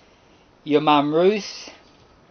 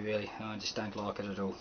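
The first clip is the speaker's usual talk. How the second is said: usual speaking voice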